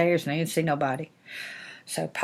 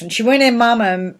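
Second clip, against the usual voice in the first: ordinary delivery, nothing marked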